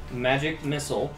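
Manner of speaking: ordinary talk, nothing marked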